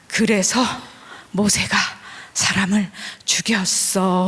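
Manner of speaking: slow, suspenseful build with a long drawn-out last syllable; climactic storytelling. Retold or imitated. Telling children a story at its climax, dramatic and drawn out. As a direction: spin out the climax for wide-eyed kids. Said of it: "클라이막스를 막 조장했습니다".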